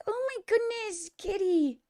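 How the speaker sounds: high cooing pet voice; cooing at cat. Real, not acted. Speaking to a cat.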